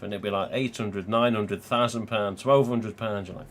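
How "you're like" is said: usual speaking voice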